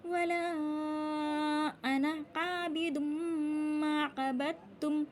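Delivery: long-held vowels; recitation demo. Shown as a demonstration. Teacher showing the cut alif, slow recitation. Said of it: "आपने इसको कैसे पढ़ना है?".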